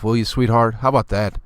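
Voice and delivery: usual speaking voice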